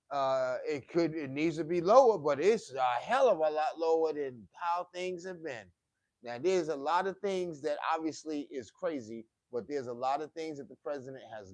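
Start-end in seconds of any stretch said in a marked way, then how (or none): 0.1-8.5 getting loud. Real, not acted. Rant gets heated.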